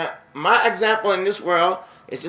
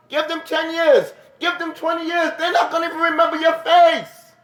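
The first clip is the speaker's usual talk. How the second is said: preaching at full force; 10 semitones higher than usual. Acted. Preacher warning the congregation they will be forgotten, building fervour. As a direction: build the warning up like a preacher reaching his peak.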